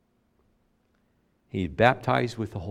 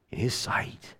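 breathy whispered close; 8 dB quieter than usual. Real, not acted. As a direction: let it fall into a whisper. Whispered end of aside.

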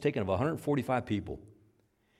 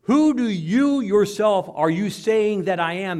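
loud, slow, pressing question; insistent preaching; 5 semitones higher, 6 dB louder, slower than usual. Acted. Preacher voicing Jesus pressing his disciples, loud and slow. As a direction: hammer the question home.

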